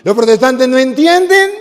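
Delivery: preacher calling a line to the congregation; loud cue to repeat. Shown as a demonstration. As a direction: call the line out for them to answer. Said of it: "Otra vez".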